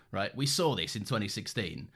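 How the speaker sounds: ordinary delivery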